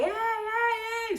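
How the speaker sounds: crowd yelling. Retold or imitated.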